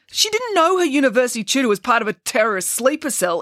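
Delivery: high-pitched, heated defence; exclaiming. A narrator's reading. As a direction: protest it in an outburst. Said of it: "exclaimed Bernie".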